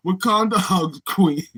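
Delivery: words breaking into a long laugh; cracking up for real; helpless laughing. Real, not acted. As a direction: blurt it out between laughs. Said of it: "I can't stop laughing".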